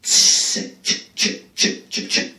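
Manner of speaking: mock echo effect, trailing off; fake echo. Vlogger testing the room's echo, mock echo. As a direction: echo the word like a big hall. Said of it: "Is that echoey enough for you?".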